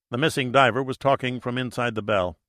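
ordinary delivery, nothing marked

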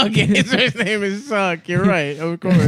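ordinary delivery

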